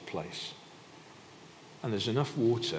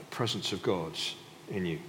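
ordinary talk

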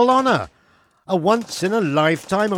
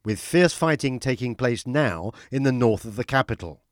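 formal newsreader delivery; news read; 6 semitones lower than usual. Acted. News anchor reads bulletin.

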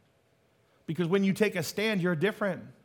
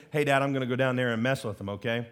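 lower, fast, casual son's voice; playful lower voice of a son making plans; Jesus talking to his Father; 7 semitones lower, faster than usual. Retold or imitated. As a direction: toss it off like a cheeky son to his dad.

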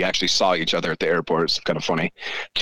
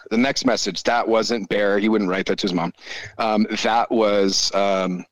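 usual speaking voice

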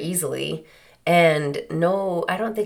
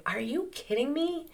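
exasperated, high-pitched reassurance; higher voice, scolding then soothing a panicker; acting out an incredulous "settle down, it's handled"; God; 6 semitones higher, slower than usual. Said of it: "he's going".

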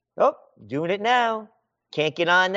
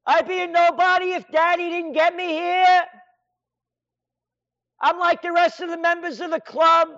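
higher, spoiled governor voice; mock Cuomo; 6 semitones higher than usual. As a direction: play a bratty spoiled governor, pitched up. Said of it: "I'm a moronic de Blasio".

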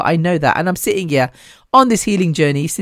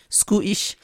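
attempted Scottish accent with a tight "oo" in Scottish; bad Scottish accent; 3 semitones higher, 7 dB quieter than usual. Shown as a demonstration. Speaker testing the author's nationality aloud, badly faked Scottish accent. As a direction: softly try out a Scottish "Scootish". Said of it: "can't do a Scottish accent".

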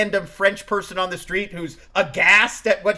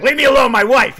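higher, louder mock outburst; mock frantic snap; 6 semitones higher, 10 dB louder than usual. Ford's character brushing off a colleague, mock frantic outburst. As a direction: brush someone off in a hammed-up panic. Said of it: "he doesn't just be like".

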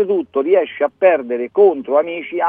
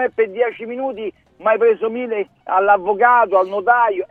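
complaining client; 6 semitones higher than usual. Retold or imitated. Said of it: "come quando dicono".

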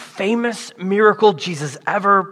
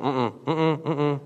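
humming no; 3 semitones lower, 9 dB quieter, slower than usual. Retold or imitated. Peter shaking off the question.

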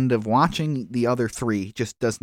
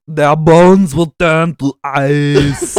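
loud, slow, higher hammed-up Arnold; Arnold impression; 4 semitones higher, 10 dB louder, much slower than usual. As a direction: bellow it like Schwarzenegger's Freeze. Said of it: "I wanna hear ... from you".

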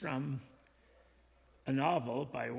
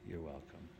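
quiet, lower welcome; soft and low; 7 semitones lower, 7 dB quieter than usual. Retold or imitated. Teacher re-voicing the doctor's reply to Olive, soft and low. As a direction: murmur it kindly, barely above breath. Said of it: "what does she say beautifully".

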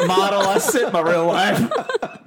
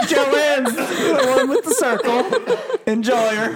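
put-on old man voice; 8 semitones higher, slower than usual. Acted old husband quote.

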